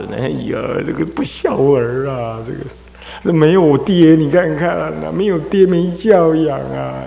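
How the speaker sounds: mildly weeping over an unfilial son; mother's lament. Retold or imitated. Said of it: "他妈就哭啊".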